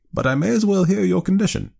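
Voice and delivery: high-pitched, resolute aunt; firm. A narrator's reading. Aunt allowing her nephew his condition, firmly. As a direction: concede it high, still firm. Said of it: "she said firmly".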